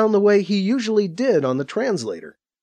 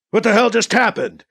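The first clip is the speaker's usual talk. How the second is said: bellowing admiral; 6 semitones higher, 4 dB louder than usual. A narrator's reading. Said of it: "bellowed over the open comm".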